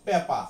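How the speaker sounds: পেপা with no r sound; পেপা, the r left out; non-rhotic paper. Shown as a demonstration. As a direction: say পেপা, no final r. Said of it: "ওরা উচ্চারণ করবে".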